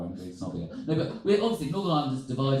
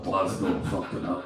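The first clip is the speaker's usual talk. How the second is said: low-pitched try at Glasgow Scottish; imitated Scottish, deep and tentative; attempted Scottish accent; 10 semitones lower, slower than usual. Shown as a demonstration. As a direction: have a rough go at a Scottish accent. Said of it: "able to do a Scottish accent".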